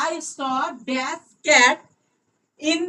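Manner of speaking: reading the sentence very slowly. Shown as a demonstration. Teacher reads the exercise aloud slowly for students. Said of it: "reading the exercise aloud".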